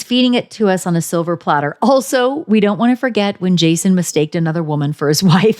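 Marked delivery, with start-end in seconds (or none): none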